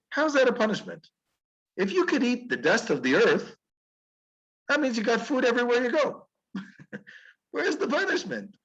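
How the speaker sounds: high, amazed disbelief; incredulous question. Retold or imitated. Rabbi scoffing at the snake's so-called punishment, incredulous and high. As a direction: scoff at the idea with a laugh.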